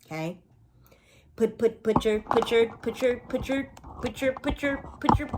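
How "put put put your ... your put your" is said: high, choppy repeated stutter like a skipping record; playful stutter. Acted. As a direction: stutter it rhythmically, pitched up. Playful stuttered rhythmic chant.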